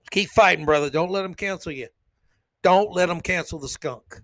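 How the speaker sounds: ordinary delivery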